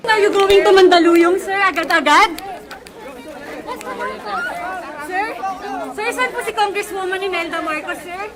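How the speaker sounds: calling out. Real, not acted. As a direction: shout questions over the crowd. Reporter calling out to candidate.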